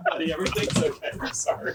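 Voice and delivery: high, voiceless words squeezed through laughing; trying to talk while cracking up; helpless laughter. Real, not acted. Cracking up, soothing the dog.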